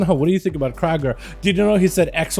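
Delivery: usual speaking voice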